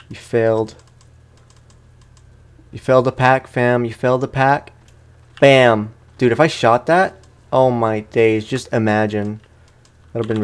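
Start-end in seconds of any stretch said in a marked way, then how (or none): none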